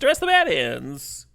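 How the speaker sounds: usual speaking voice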